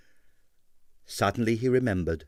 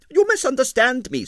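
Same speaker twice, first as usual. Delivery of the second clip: emphatic impresario; 5 dB louder than usual. A narrator's reading. Stroganoff corrects Quill.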